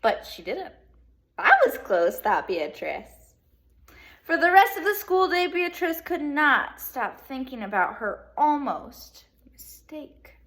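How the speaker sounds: lively read-aloud to second graders; bright classroom storytelling; storybook reading. Acted. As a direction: read it like story time for kids.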